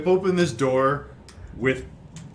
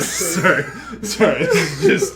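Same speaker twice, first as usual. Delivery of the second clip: high, loud, helpless laughter breaking up words; cracking up while trying to say sorry; laughing apology; 7 semitones higher, 8 dB louder than usual. Real, not acted. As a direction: apologise through real giggles. Game master cracking up, apologising.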